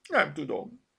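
high, plaintive little voice; whimpering. A narrator's reading. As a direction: whine it as if near tears. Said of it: "pityergett Zorka". (pityergő, magas hang)

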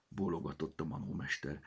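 plain reading voice

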